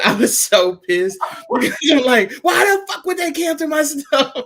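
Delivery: laughing, shouting in mock fury; furious disbelief with a laugh; angry outburst. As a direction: blow up high and loud, half laughing. Angry retelling of Target cancel.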